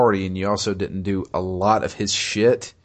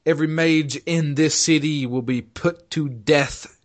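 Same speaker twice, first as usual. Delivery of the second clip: a touch of a raised, higher ruling voice; furious decree; 6 semitones higher than usual. Retold or imitated. Knight Commander ordering all mages killed, furious. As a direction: proclaim the death order in rage. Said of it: "she's like".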